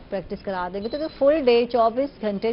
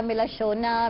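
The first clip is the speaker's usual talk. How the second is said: ordinary talk, nothing marked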